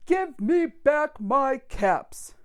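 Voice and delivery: cross demand. Acted. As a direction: spell out each word as a stern demand. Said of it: "he said, shaking a finger at them".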